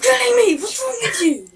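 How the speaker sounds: yelling in real frustration; furious, high, loud protest; angry shout. Real, not acted. Friend keeps killing him in game.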